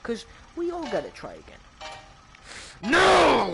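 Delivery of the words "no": high, loud cry of despair; anguished shout. Real, not acted. Gamer shouts as his retry fails. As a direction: yell it out at the failure.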